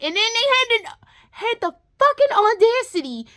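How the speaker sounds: building to a high loud outraged pitch; outraged rising rant. Real, not acted.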